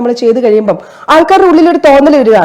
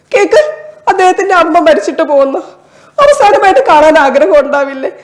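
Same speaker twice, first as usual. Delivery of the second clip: higher pitched, pleading and near tears at the airport counter; desperate stranded passenger; 4 semitones higher than usual. Acted. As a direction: beg the staff, voice cracking into sobs. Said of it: "ഒന്ന് ഞാൻ അവതരിപ്പിക്കാനായിട്ട് try ചെയ്യാവേ".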